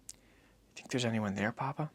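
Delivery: put-on soft, slow, hushed child's question; a boy asking Papa softly at dusk; quiet boy's voice. Boy quietly asks his father about the dark city, hushed.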